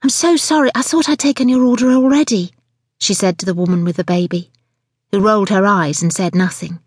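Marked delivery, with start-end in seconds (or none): 0.0-2.5 high-pitched flustered excuse; apologetic waitress. Acted. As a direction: hurry to smooth over a mistake. Waitress apologises to waiting customer.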